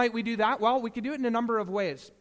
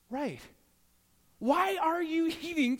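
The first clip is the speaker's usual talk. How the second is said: much higher, incredulous scolding dad; dad catching his daughter mid-scrape; exasperated dad; 9 semitones higher than usual. Retold or imitated. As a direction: call her out in disbelief.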